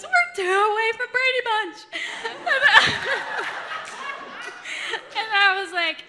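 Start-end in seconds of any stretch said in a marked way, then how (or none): 0.0-1.8 high, loud holler through laughter; imitated husband's outburst. 1.8-5.1 loud, high, long laughter; real laughing fit. Real, not acted. Cracks up after punchline.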